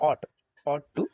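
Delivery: ought with the Bengali অ vowel; correct pronunciation. Shown as a demonstration. Teacher spelling the sound in Bengali, pronounced with "aw". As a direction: say ought to as "awt tu". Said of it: "বাংলায় যদি আমরা বলি স্বরে অ ট".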